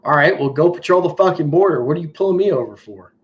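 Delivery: normal talking voice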